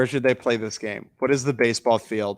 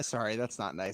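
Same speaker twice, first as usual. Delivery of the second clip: normal talking voice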